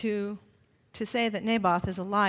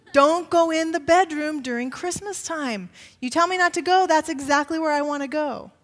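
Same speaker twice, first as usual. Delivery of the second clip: put-on higher, louder, like a kid who won't be told; playful kid tempting the forbidden; mischievous child voice; 5 semitones higher, 5 dB louder than usual. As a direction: tease it like a defiant child. Child voice defying the rule.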